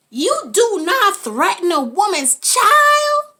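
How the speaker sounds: slow, loud, every word weighted; slow, loud warning about mothers; slow emphatic warning. Real, not acted. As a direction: lay down the law slowly.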